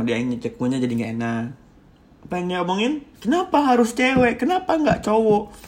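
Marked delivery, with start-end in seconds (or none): 3.2-5.5 high-pitched, pressing mimicry of a partner; jealous challenge. Jealous partner challenging the speaker over a female friend, accusing. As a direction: fire off jealous questions in a raised voice.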